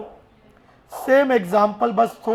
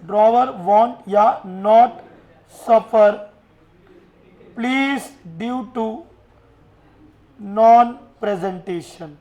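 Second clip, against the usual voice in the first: every word drawn out and louder; slow loud dictation; 5 dB louder, much slower than usual. Shown as a demonstration. Teacher slowly dictating the full clause to students.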